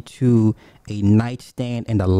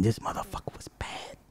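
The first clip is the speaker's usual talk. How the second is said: whisper; 2 semitones lower, 13 dB quieter than usual. Real, not acted.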